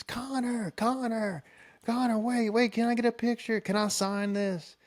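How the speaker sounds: high, excited fan voices calling his name. Retold or imitated. Fans rushing from bleachers begging the singer, high and excited. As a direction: squeal it like eager fans chasing him down.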